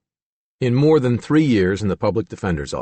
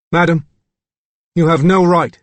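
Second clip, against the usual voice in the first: put-on crisp British accent, higher and louder; upper-class British accent; 7 semitones higher, 7 dB louder than usual. A narrator's reading. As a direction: Arthur in clipped upper-class British, jaw barely moving. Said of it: "crisp, upper-class British accent".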